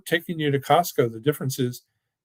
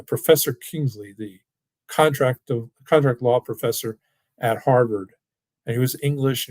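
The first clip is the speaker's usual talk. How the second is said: ordinary talk, nothing marked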